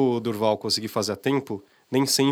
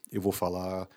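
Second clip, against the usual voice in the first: put-on a lower, softer older-person voice; deeper voice; 5 semitones lower, 8 dB quieter, slower than usual. Shown as a demonstration. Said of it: "uma coisa mais grave".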